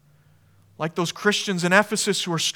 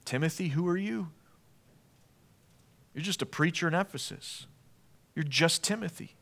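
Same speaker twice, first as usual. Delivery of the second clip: much softer, condescending put-down; quiet belittling; 10 dB quieter than usual. Retold or imitated. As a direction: murmur it down at him, dismissive. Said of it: "They're gonna fight Timothy".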